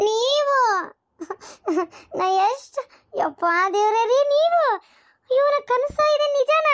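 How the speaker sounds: high-pitched, breathless joyful shock; stunned disbelief. Acted. Wife seeing her husband home unexpectedly, astonished.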